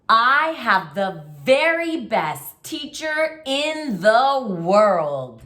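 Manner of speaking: high, slow, excited read-aloud; with excitement. Shown as a demonstration. Teacher to class, reading the sentence with excitement. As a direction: read it like it ends in an exclamation point. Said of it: "Let's read it with that exclamation point".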